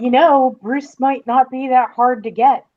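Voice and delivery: normal talking voice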